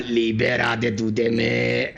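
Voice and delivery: said low and drawn out; deep slow dramatic delivery; low slow mock line. Repeats quote lower, slower.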